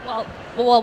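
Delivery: usual speaking voice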